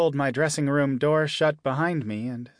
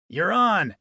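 loud call, higher pitched; bellow; 5 semitones higher, 4 dB louder than usual. Acted. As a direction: bark it across the room. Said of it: "the sudden bellow".

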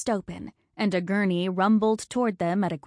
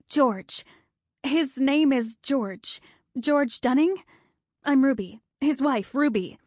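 higher and insistent, near panic; frantic; 6 semitones higher than usual. Acted. Frantic wife telling the nurse her husband's name, higher. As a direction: spell it out desperately for the nurse.

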